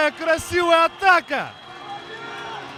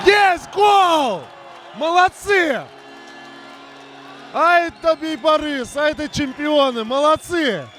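excited hockey goal call; 4 semitones higher than usual. Real, not acted. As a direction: cheer the goal and the champions. Goal and celebration.

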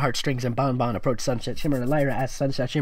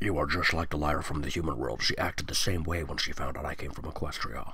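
put-on lower, softer and quick girlish reply; lower Sunset voice; 10 semitones lower, 8 dB quieter than usual. Sunset answering Princess Twilight, lower and quick. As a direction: murmur it low and quick.

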